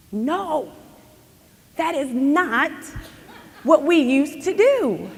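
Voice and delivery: combative protest. Retold or imitated. Girl arguing on conference calls, mild combative push-back. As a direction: push back, half laughing.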